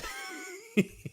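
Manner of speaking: breathy high giggle; laugh. Real, not acted. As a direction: burst into a helpless little laugh. Laughs at her character passing out.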